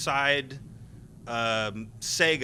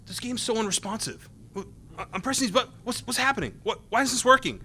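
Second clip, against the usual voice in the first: imitating a confused player, pitched up; baffled frustration; 7 semitones higher, faster than usual. Imitating a frustrated game tester.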